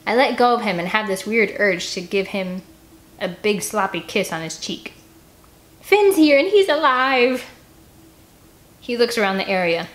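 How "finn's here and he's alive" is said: high, loud and slow joyful call; excited shout. Acted. Annie telling everyone Finn is alive, excited call. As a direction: announce it with joy.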